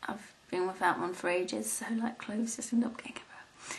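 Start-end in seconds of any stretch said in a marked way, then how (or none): 1.7-3.7 soft unvoiced whisper. Real, not acted. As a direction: whisper it as an aside. Sudden aside about mess.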